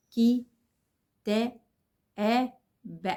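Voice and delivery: "كي، تا، آ، ب" as separate sounds with a gap after each; choppy spelling-out. Shown as a demonstration. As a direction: imitate a child sounding out "كي، تا، آ، ب" piece by piece. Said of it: "النطق اللي هو المتقطع ده". (تهجّي "كي، تا، آ، ب" متقطّع صوت صوت)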